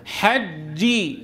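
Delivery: very slow حجي, held hard closure on the doubled ج; strong voiced jeem. Shown as a demonstration. Teacher models for repetition, slow firm ج in حجي. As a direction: lock the sound on ج in حجي, then release. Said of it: "بننطقها شديدة مجهورة".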